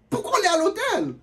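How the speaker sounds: high, loud exasperated question; indignant outburst. Retold or imitated. Comedian venting to the crowd, high and loud. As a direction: burst out in disbelief.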